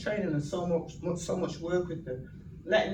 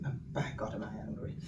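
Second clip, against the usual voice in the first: low intense admission; 7 semitones lower, 9 dB quieter, faster than usual. Retold or imitated. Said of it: "I said to him".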